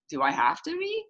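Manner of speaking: put-on high-pitched whiny-kid reluctance; reluctant reply. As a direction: push back with a high, rising question. Acting a reluctant friend's reply.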